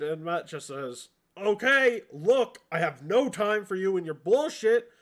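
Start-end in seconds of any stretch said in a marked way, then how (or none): 1.4-4.9 loud, raised, fed-up ultimatum; impatient warning. Acted. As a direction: lay down the law, out of patience. Matt confronts the kobolds impatiently.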